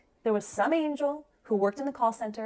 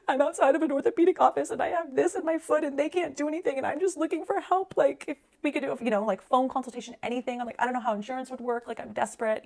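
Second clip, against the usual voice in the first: higher, pleading voice like a crying caller; tearful desperate plea; 7 semitones higher than usual. Retold or imitated. Leaving a desperate voicemail for a surgeon, higher and tearful. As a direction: beg for help through held-back tears. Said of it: "crying, like".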